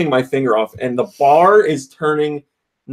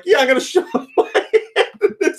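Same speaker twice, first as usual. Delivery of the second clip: high, incredulous voice breaking into laughter; hammed-up squeaky objection; retold sarcasm. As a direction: protest in mock disbelief through laughs. Retold sarcastic refusal of dirty glove.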